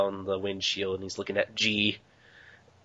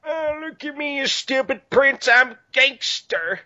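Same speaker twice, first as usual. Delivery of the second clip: high, slow swaggering tough-guy voice; mock gangster boast. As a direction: strut it out like a silly gangster.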